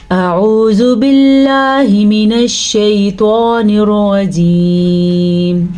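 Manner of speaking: slow, drawn-out melodic tajweed recitation; Quran recitation. Shown as a demonstration. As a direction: chant each verse slowly with long held vowels. Full tajweed recitation of Fatiha. (سست، لمبی آواز میں تجوید کے ساتھ تلاوت)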